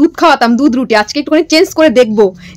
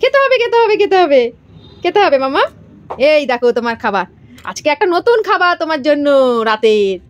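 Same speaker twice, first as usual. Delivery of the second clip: high singsong coaxing, much higher than her usual voice; 4 semitones higher than usual. Real, not acted. Mother coaxing little Sanvi to come and eat, high playful baby talk.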